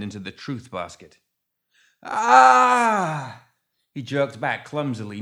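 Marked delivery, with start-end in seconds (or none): 2.0-3.5 loud, high, drawn-out yell; scream of pain. A narrator's reading. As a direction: yell as if bitten. Renfield bitten by snake.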